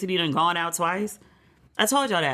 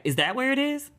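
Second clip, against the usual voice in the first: mock clueless surprise; 8 semitones higher than usual. Clueless about the World Cup.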